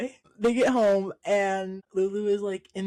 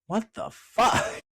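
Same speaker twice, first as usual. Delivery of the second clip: drawn-out, higher mock disbelief; a mom appalled at her husband; slow, stunned what-the-fuck; shocked outrage; 6 semitones higher, slower than usual. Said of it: "the mom is like".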